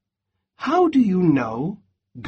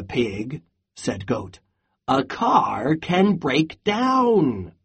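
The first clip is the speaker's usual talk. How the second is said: storybook read-aloud with voiced animals; lively picture-book narration; reading to children; 3 semitones lower, slower than usual. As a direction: read it like a bedtime story for a class. Teacher reads picture book aloud.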